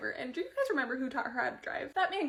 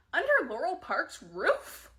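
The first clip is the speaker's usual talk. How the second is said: scandalised exclamation; 6 semitones higher, 8 dB louder, much slower than usual. Real, not acted. Scandalised reaction to scene.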